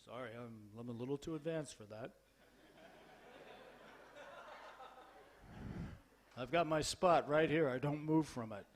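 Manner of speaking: low, soft, aloof refusal; mock the dignified senior who won't budge; subdued, hammed-up reserve; too advanced. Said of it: "when you become advanced, you don't dance".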